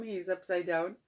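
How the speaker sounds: ordinary delivery, nothing marked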